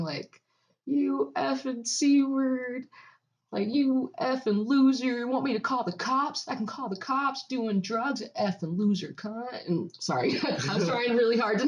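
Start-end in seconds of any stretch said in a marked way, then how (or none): 0.9-9.7 higher, drawn-out mock yell; retold yelling. Woman imitating her neighbor yelling outside, mock yell. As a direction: imitate a neighbor's tirade without full volume. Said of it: "and she's yelling".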